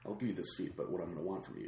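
mock seductive bargain; sly proposition. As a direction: murmur it like a seductive bribe.

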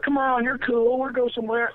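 very high mock girl voice; coaxing girl. Girl talking her date into going out, mock coaxing. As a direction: wheedle in a put-on high voice.

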